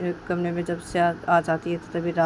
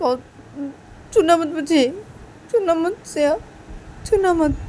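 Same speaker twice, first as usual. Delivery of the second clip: hurt angry rant; 6 semitones higher than usual. Acted. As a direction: pour out the hurt and anger of being slapped. Shruti tearful angry rant.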